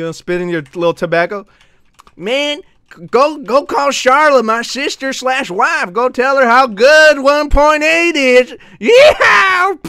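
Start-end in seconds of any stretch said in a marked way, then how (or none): 2.2-9.8 high, slow hammed-up cowboy drawl. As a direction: build to a shouted cowboy yee-haw. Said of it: "I'll do the cowboy voice".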